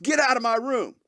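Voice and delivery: high-pitched exasperated mock yell; retold angry yell. Answering the dog's excuse, high angry yell. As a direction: cut the dog off, indignant and high. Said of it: "and you're mad".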